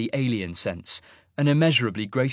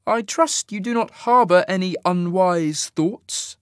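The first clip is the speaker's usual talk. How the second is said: much higher, slow, coldly measured warning; cool veiled warning; 8 semitones higher, 4 dB louder, slower than usual. Acted. Fade officer warning Jarek, cold and slow. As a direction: warn him with icy calm.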